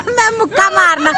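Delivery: high, loud, laughing mock threat; playful threat. Real, not acted. Wife laughing as she jokingly threatens husband with a punch.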